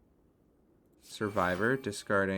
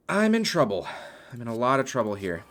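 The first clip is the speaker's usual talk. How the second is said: sudden alarm; 2 semitones higher, 5 dB louder than usual. Real, not acted. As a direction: blurt it out as danger hits. Player alarmed by sudden danger.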